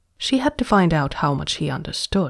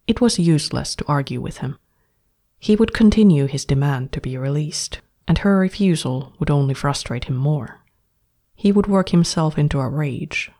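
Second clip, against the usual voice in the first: plain reading voice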